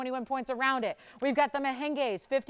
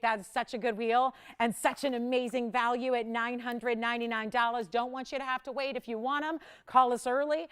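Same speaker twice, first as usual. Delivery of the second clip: usual speaking voice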